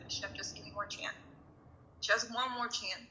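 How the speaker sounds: murmured plea to God, very soft; desperate quiet prayer from a hospital bed; hushed prayer. Retold or imitated. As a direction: pray it under your breath.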